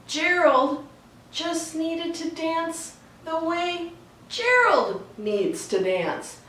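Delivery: usual speaking voice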